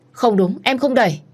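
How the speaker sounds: high, loud panicked protest; desperate denial. Acted. Wife denying she pushed the woman, desperate cry. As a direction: plead innocence in panic.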